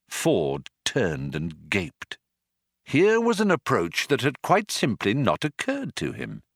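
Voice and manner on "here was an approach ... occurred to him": usual speaking voice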